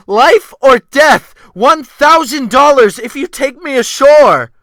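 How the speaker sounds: desperate shout. Acted. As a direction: beg for rescue across the sea at full voice. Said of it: "I shouted at the top of my lungs".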